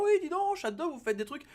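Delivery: high, sudden fake astonishment; squeaky imitation of amazed viewers; mock surprise.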